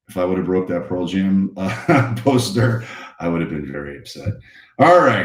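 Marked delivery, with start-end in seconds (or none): none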